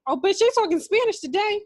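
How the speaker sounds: high, loud disbelieving exclaim; shocked. Real, not acted. Friend's jail call plays in Spanish, loud shocked outburst. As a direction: react like the call just blindsided you.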